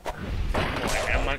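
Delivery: loud burst of relief; relieved curse. Real, not acted. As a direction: blurt it out, relieved. Relief after close call. (alivio en voz alta)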